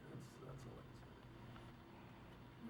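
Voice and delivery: too moved to go on, so let it fade to nothing; choked whisper. Real, not acted. Remembering his friend Robin, his voice breaks into a whisper. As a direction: voice drops away to a slow, breathless whisper.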